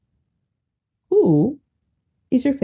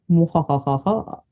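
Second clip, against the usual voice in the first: evil cackle. Acted. Host's evil laugh.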